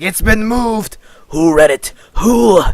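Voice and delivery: high, very loud raspy outburst; Zane's deranged raspy shout. Acted. Zane demanding who read his research, deranged raspy shout. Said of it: "Just do a raspy voice".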